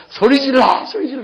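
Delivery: high, loud call to shout; urging shout. Retold or imitated. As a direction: go round urging them to cry out. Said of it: "소리를 안 지르면 저는 돌아다니면서". (소리 지르라고 높게 외치며)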